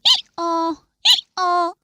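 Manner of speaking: loud high hee-haw bray; donkey bray. A narrator's reading. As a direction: bray like a donkey joining the trumpets. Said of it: "I brayed and brayed to join the trumpets".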